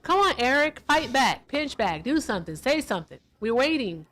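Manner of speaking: taunting. Real, not acted. Taunting the caller to respond.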